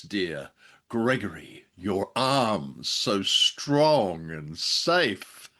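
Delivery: ordinary talk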